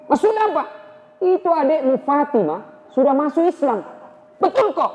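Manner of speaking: a gossip breaking news eagerly; high townsman voice. Acted. Townsman tells Umar his sister converted, high insistent voice.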